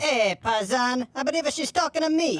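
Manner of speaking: two barbers squabble over a razor, stage Italian; Italian barber accent. Acted. Barber accuses his partner about the razor, hammed-up Italian accent.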